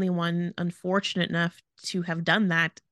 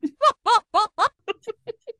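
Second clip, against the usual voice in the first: high cackling mock evil laugh; 7 dB louder than usual. Cohost asked for an evil laugh, gives a high cackle. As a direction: cackle like a cartoon villain. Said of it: "You have to do, like, an evil laugh".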